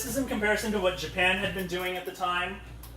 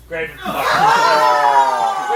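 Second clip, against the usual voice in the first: loud, slow, drawn-out title with a laugh; mock grand announcement; 5 semitones lower, 11 dB louder, much slower than usual. Presenter announcing the film title to the class, loud and drawn out. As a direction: boom the title like a blockbuster.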